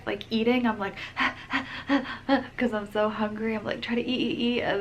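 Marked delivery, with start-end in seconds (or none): 1.0-2.5 imitated quick, high panting; out-of-breath panting. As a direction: act out gasping for air while eating. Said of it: "I am out of breath".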